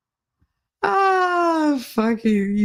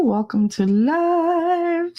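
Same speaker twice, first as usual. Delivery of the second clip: drawn out greeting; 10 semitones higher, much slower than usual. Real, not acted. Greeting a viewer, singsong.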